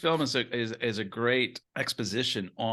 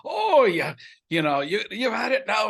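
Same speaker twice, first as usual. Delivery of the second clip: high, loud mock-Scottish outburst; hammed-up impression of an irritated dad; irritated Scottish father; 12 semitones higher, 7 dB louder than usual. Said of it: "he'd say something that would irritate".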